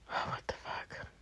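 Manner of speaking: under-the-breath whisper; whispered aside. Acted. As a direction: mutter it so no one hears.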